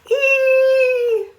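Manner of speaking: a sudden high squeal, loud; high shrieking cry. Acted. Penelope shrieking at the goldfish bite, high loud squeal. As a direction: shriek like a bitten child. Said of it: "cried Penelope".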